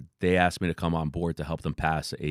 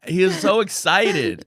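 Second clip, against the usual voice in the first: laughing; 11 semitones higher, 6 dB louder, slower than usual. Real, not acted. Still laughing from the impression.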